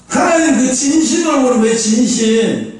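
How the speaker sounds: ordinary talk, nothing marked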